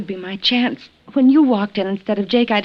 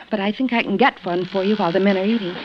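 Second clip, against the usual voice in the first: ordinary delivery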